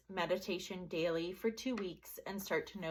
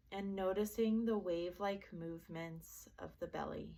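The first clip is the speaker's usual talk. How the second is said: guided meditation; 4 dB quieter than usual. Acted. Teacher leading listeners through a body relaxation, soft guided meditation. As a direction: gently guide them into relaxation, leaving space between phrases.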